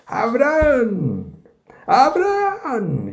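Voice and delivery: high, slow, drawn-out call from heaven; the angel calling down to Abraham, high and slow. Shown as a demonstration. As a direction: stretch each name out like a voice crying from the sky. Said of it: "Hay que leerlo así".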